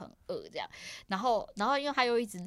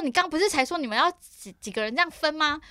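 retold angry retort; 9 semitones higher, 8 dB louder than usual. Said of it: "我就说".

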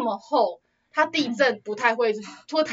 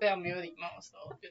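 whispered aside; 3 semitones lower, 14 dB quieter, faster than usual. Real, not acted. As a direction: whisper it much softer. Repeats the phrase under her breath. (小声耳语般说)

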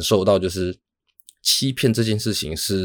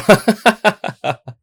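big laugh; 14 semitones higher, 8 dB louder than usual. Real, not acted. Bursts out laughing at own thought.

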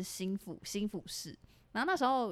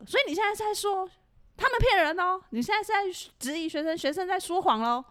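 higher and louder, accusing; fierce scolding; 9 semitones higher, 6 dB louder than usual. Retold or imitated. Senior officer fiercely scolding the new squad leader. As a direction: come down hard on him, accusing. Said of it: "学长就很凶的说".

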